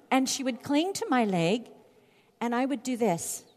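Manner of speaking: ordinary talk, nothing marked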